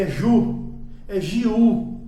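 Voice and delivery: "ju" and "jiu" said slowly, loud and distinct; common mispronunciation. Shown as a demonstration. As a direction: say "ju", then "jiu" in two syllables, as learners do. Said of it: "E muita gente diz".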